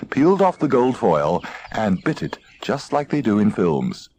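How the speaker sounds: plain reading voice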